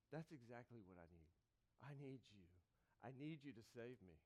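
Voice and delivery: very soft, low and humble; hushed prayer. Pretend. Humble believer quietly asking God for help, hushed. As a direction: pray it under your breath.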